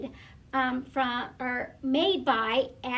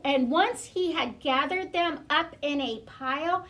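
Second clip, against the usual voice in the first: usual speaking voice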